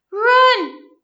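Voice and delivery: put-on a boy screaming at his gang to flee the smoke; panicked yell. A narrator's reading. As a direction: scream it to make everyone flee. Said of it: "Ringo yelled".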